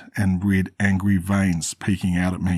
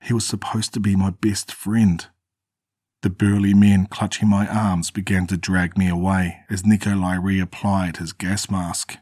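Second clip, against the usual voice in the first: plain reading voice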